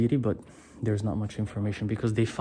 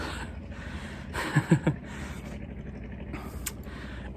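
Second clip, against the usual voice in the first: breathy laugh; 4 semitones higher, 5 dB quieter than usual. Real, not acted. Laughs at being mistaken local.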